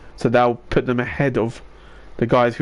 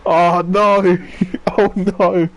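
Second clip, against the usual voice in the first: shocked laughter; 6 semitones higher, much slower than usual. Real, not acted. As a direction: laugh out in disbelief. Crash again, laughing.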